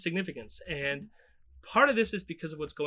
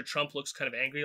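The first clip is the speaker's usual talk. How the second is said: usual speaking voice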